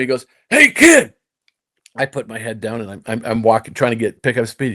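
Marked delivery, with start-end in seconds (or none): 0.5-1.2 high, loud call to stop someone; imitated yell. Golf tough calling after a fleeing young player, yelling. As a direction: holler it sharply higher and louder. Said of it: "he yells at me".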